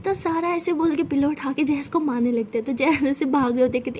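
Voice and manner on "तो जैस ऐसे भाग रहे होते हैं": usual speaking voice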